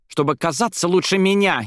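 high, heated accusation; indignant, won't let it drop; insistent. A narrator's reading. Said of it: "не унималась та".

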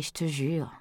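weary sigh of exasperation. A narrator's reading. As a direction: let it out on a sigh. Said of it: "soupira Aurore".

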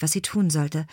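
plain reading voice

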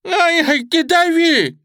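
put-on very high, loud old woman's shriek; frantic screaming. Hostage woman held out of window, screaming frantically. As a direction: scream it out in pain and panic. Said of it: "истошно кричала та".

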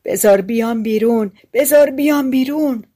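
lightly acted captive's cry; muffled trapped voice. A narrator's reading. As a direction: call out from confinement, rising in urgency.

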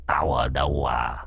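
low, soft ghostly menace; haunt them with an eerie low voice. Acted. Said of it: "giọng ma quái ghê rợn".

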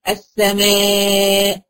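السماء with the long alif held well before the hamza; السماء بمد طويل; stretched madd. Shown as a demonstration. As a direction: draw out the alif in السماء, then stop on the hamza. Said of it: "شايفة المد أهو".

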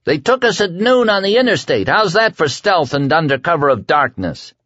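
shout. A narrator's reading. Larry shouting back at Flagg's charges. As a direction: shout it out in bitter mockery. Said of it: "He raised his voice to a shout".

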